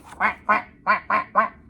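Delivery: loud indignant quacks; angry quacking. A narrator's reading. Duck scolding the cat, loud quacks. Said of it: "quacked angrily at the cat".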